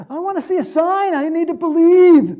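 high, loud, hammed-up demand; exaggerated insistent cry; mock sign-seeker. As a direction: plead loudly for proof, pitch way up.

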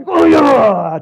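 high, very loud whooping shout; big drawn-out holler; fan's cheer. Acted. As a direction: roar it like a golf spectator. Imitating golf crowd's cheer.

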